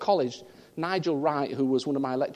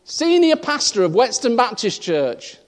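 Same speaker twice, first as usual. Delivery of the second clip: mock headline; 5 semitones higher, 8 dB louder than usual.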